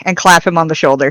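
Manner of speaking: normal talking voice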